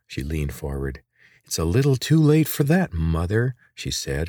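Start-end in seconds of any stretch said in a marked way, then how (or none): 1.4-3.5 a touch of sarcasm, higher then dropping on Mother; mock the absent mother lightly; girl mildly sneering at her mother; sarcastic. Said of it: "her voice thick with sarcasm".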